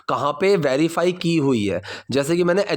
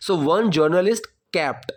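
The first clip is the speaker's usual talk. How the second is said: slow model sentence; much slower than usual. Shown as a demonstration. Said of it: "teacher models the sentence".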